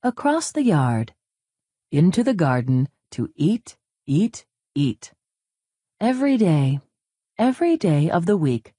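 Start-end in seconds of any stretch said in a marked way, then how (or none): none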